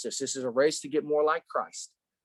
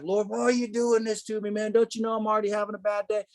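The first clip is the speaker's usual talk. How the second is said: higher-pitched, exasperated plea to God; retold frustrated protest; fired-up complaint; 6 semitones higher than usual. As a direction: act out griping at the Lord, worked up but not shouting. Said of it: "I'm getting fired up".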